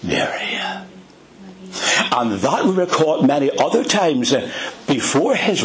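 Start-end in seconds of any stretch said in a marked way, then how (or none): 0.0-0.6 Mary said lower and softer, lovingly; tender, soft call. Shown as a demonstration. Jesus gently speaks Mary's name at the tomb, tender and soft. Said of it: "the way he said her name".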